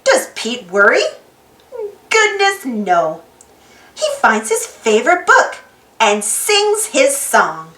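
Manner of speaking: ordinary delivery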